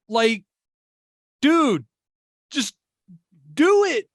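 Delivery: rising to a loud shout; building yell. Real, not acted. Building rant at owner.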